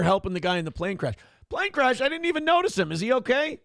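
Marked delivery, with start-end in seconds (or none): 1.5-3.6 high-pitched mock surprise; oblivious golfer. Golfer told about the plane crash he didn't notice, mock surprised. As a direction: play a golfer who missed the obvious.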